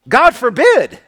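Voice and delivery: emphatic exclamation. Real, not acted.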